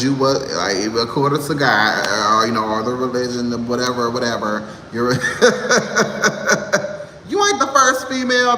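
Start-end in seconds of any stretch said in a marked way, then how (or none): 5.2-7.2 a short airy burst of laughter; real laugh. Real, not acted. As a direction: laugh it off breathily. Laughing at own jab.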